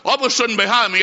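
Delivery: ordinary talk, nothing marked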